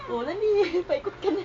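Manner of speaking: high, soft and quick coaxing. Real, not acted. Adult urging baby to roll over, high coaxing.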